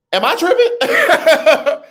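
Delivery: high, slow, laughing question. Real, not acted. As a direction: laugh it out, checking yourself.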